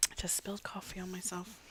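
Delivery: hushed whisper. Real, not acted. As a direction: whisper it ruefully. Whispers many lines.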